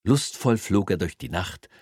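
usual speaking voice

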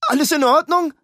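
loud high anxious call. Acted. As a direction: shout the question up to the balcony. Ruben calls up to balcony. (besorgt nach oben gerufen)

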